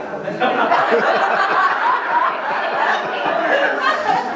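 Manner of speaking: giggling hard at himself; squeaky helpless laugh; high-pitched giggle. Real, not acted.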